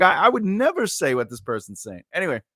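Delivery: ordinary talk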